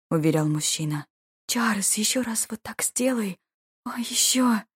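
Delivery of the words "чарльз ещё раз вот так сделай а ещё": high, whispery female plea; breathy pleading. A narrator's reading. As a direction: beg for more in a raised half-whisper. Wife's breathy plea in bed. (страстная мольба высоким полушёпотом)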